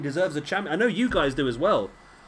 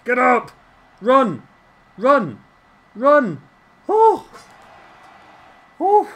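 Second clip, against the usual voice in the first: shouting high and loud at the screen; yelling for his wrestler to get up; loud cheering; 8 semitones higher, 9 dB louder, slower than usual. Real, not acted. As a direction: cheer him on at full volume.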